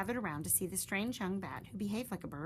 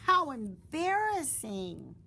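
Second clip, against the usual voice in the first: embarrassed; 6 semitones higher, 8 dB louder, slower than usual. A narrator's reading. Narrator exclaims embarrassment.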